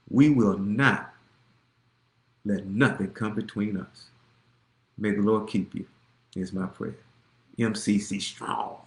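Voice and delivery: low, very soft sign-off; hushed benediction. Real, not acted. Pastor closing with a blessing for the congregation, hushed.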